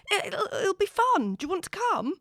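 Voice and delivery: stammering hopeful plea; desperately asking him to the party; nervous. A narrator's reading. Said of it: "she blurts out".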